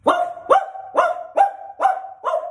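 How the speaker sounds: high, loud yappy barking woofs; imitated dog bark. Shown as a demonstration. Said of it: "the last sound is".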